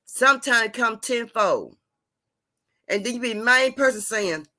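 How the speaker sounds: ordinary talk, nothing marked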